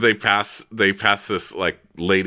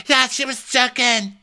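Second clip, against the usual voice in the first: much higher, breezy voice; chirpy dismissal; 13 semitones higher than usual. Retold or imitated. The others shrugging off Brandy's joke, high breezy. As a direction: brush it off lightly. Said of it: "they're like".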